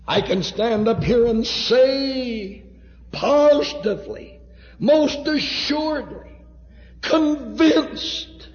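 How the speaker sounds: high, drawn-out, impassioned pulpit cry; full-force preaching. Real, not acted. Preacher testifying to precious hearts of his salvation, crying it out.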